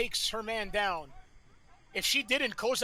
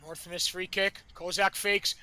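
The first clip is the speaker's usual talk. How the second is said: normal talking voice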